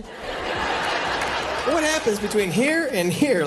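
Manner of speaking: drawn out very slowly, baffled; slow puzzled question. Acted. Comic asking the crowd about dead spots, very slow. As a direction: stretch every word, baffled.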